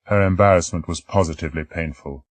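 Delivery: ordinary delivery, nothing marked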